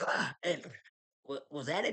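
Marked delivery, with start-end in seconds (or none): 0.1-0.3 short imitated scream of fright; mock scared yelp. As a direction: yelp as if startled, playing it up. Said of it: "he's jumped out there scared like".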